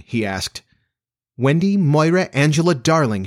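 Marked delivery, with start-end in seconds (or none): 1.4-3.2 higher-pitched, proudly presented full name; self-satisfied. A narrator's reading. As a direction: announce it with some satisfaction. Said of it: "she replied with some satisfaction".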